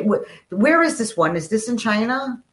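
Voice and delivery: ordinary talk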